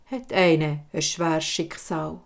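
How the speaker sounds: ordinary delivery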